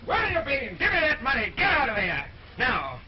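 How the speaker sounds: angry commands. Acted. King berating the servant with the money, angrily. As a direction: shout the servant in and straight out.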